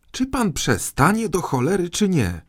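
very high-pitched angry demand; exasperated outburst. A narrator's reading. Boy king demanding the minister stop, exasperated. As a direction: snap at him at the end of your patience.

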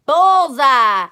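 loud triumphant cry; happy shout. Acted. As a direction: shout it out with glee. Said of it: "I shouted, very happy".

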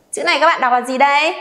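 ordinary delivery

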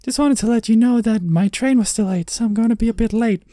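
high-pitched put-on mother voice; annoyingly high mum impersonation; mom voice. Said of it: "That's gonna get annoying".

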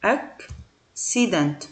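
"accident" slowly spelled out, ak-si-dent, hard k then s; teacher says "accident" syllable by syllable, ak-si-dent; careful slow model word. Shown as a demonstration. Teacher modelling "accident" slowly for pupils, careful pronunciation. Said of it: "يعني اما اقول مثلًا accident".

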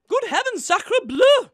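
flustered high exclamation; high, loud, alarmed French chef. Acted. Pricked herself, exclaims in French.

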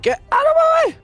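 high, loud frantic shout; real yell. Real, not acted. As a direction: yell in panic mid-fight. Gamer yelling during fight.

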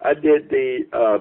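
normal talking voice

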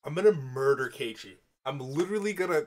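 loud, exasperated outburst at a character; mock furious. Real, not acted. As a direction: yell in outraged frustration.

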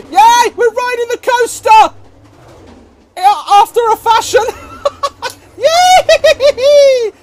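high, loud squeals of glee; jubilant cheering. Real, not acted. Player finally riding the coaster, jubilant cheering. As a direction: cheer with laughter breaking through.

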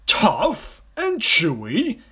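loud, high, very drawn-out echo; crocodile's incredulous cry. A narrator's reading. Enormous crocodile echoing the smaller one, loud and incredulous. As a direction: scoff slowly in disbelief. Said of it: "cried the enormous crocodile".

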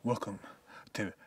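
slow, low, soft newsreader intro; mock news anchor. Opening a pretend broadcast, slow mock anchor voice. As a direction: announce it like the evening news. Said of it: "B C C News".

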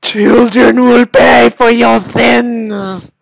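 slow, high, loud proclamation; hammed-up doom cry. Gamer cursing the developers' children, hammed-up doom cry. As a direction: proclaim doom like a hammy preacher.

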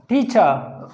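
"टीचर" ending in a long uh; silent r. Shown as a demonstration. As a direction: draw out the last vowel of "टीचर" without r. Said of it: "र नहीं बोलते".

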